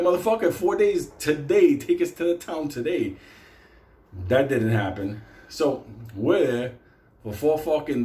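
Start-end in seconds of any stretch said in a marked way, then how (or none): 0.0-3.2 high, indignant retold protest; incredulous demand. Stranded men demanding a ride today, mock outrage. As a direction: insist indignantly, pitch raised.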